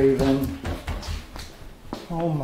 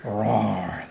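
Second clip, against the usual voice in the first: gentle, low pretend roar; dinosaur roar; 6 semitones lower than usual. Voicing a toy dinosaur for toddler.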